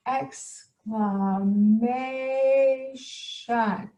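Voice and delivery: extremely drawn-out showy word; hammed-up, long-held syllables; playful exclaiming. Shown as a demonstration. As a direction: sing out each syllable very slowly. Playfully demonstrating exclamations as exciting.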